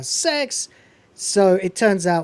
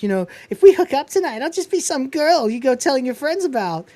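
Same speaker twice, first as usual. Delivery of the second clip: put-on girl's voice; 8 semitones higher than usual. Girl voiced in retold scene.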